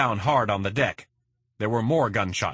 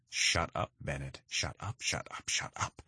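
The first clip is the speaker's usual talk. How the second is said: softer and softer, down to a whisper; hushed, urgent shut up; 5 semitones lower, 9 dB quieter than usual. A narrator's reading. Alex hushes Bennett under a rifle, fading to a whisper. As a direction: silence him without the gunmen hearing. Hissed at partner mid standoff.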